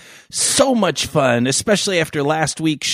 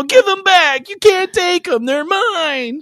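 high-pitched mock pleading; hammed-up childish protest, pitched way up; protesting kid. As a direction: wail like a kid robbed in play. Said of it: "And I'm all".

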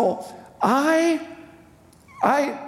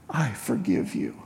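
low, soft father forgiving; a quiet, moved dad's reply; 10 semitones lower, 5 dB quieter than usual. Retold or imitated. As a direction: lower and softer as the father forgiving his son. Dad grants forgiveness.

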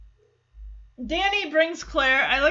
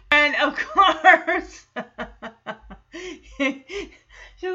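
laughing; 4 dB louder, much slower than usual. Real, not acted. Laughing while retelling scene.

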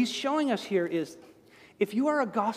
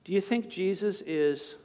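ordinary talk, nothing marked

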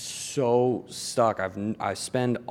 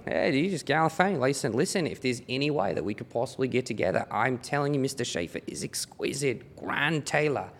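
higher-pitched put-on Australian sales pitch; Australian accent; 4 semitones higher than usual. Shown as a demonstration. Fake assistant selling Mister Schaefer on a meeting, in an Australian accent. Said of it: "approach me with the accent".

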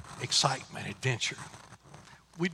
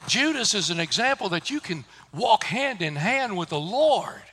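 normal talking voice

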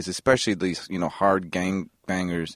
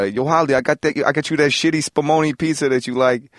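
calling out a line in character; 5 semitones higher, 4 dB louder than usual. Retold or imitated. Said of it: "I said".

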